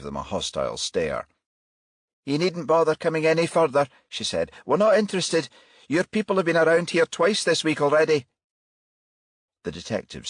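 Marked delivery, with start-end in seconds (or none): 2.3-3.9 hostile. Acted. Hostile woman at doorstep. 4.7-8.3 hostile. Acted. Hostile woman turning them away.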